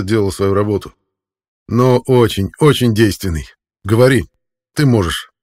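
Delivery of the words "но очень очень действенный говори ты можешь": calm emotionless voice. A narrator's reading. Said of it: "Голос Геррата звучал спокойно, без эмоций".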